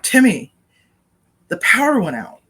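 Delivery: imitated holler across the porch; retold calling out. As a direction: call out the news urgently. Retold yelling to Timmy on porch.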